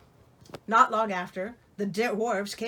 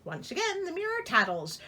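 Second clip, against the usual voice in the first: ordinary delivery, nothing marked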